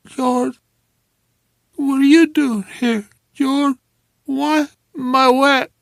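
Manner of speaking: high, dazed and tearful; groggy drugged woman, near tears; confused woman. Acted. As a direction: wake up bewildered and whimpering.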